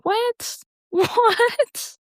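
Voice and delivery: high, squeaky disbelieving what; a laughing, sky-high what; incredulous what. Real, not acted. Disbelief at being told hell.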